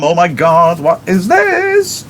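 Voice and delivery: high, drawn-out mock panic on what is this; the startled family hears the doorbell, hammed-up alarm. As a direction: squeal it out in mock shock as the doorbell rings.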